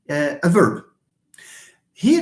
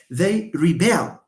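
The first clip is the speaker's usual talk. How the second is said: "rebel" as ree-BELL; stress on second syllable; slower than usual. Shown as a demonstration. Teacher models the verb, "rebel" stressed on the end. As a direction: keep "re" light and land on "bel" in "rebel". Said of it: "But the verb".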